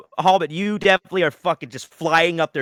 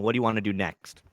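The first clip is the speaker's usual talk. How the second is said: ordinary delivery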